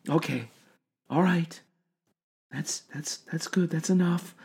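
very soft calming voice; soft reassuring. A narrator's reading. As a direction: hush an upset patient gently. Doctor calming patient.

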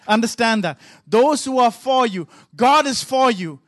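ordinary talk, nothing marked

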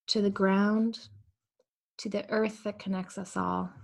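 calm, unhurried meditation voice; guided meditation. Acted. Pastor guiding the congregation into prayer, calm meditation voice. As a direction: ease them into stillness breath by breath.

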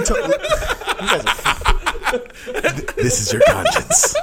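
high-pitched, breaking up with laughter; laughing helplessly. Real, not acted. Host trying to address his friends, really cracking up. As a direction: try to get the words out through real laughter.

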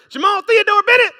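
loud, high mock-scolding mom; imitated angry mother's shout; mother's full-name summons. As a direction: holler it like a mother demanding attention. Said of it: "let him hear her say".

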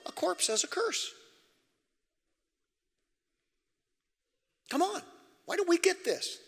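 preaching at full force. Acted. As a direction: preach it hard to the congregation. High preaching burst.

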